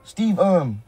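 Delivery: pretend greeting. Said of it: "go up to him and be like".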